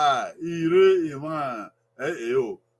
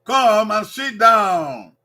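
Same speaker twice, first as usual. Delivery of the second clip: "come and sit down" drawn out, "sit" stressed; slow classroom model, leaning on "sit"; slow teaching model; 4 semitones higher, 6 dB louder, much slower than usual. Shown as a demonstration. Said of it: "Number three".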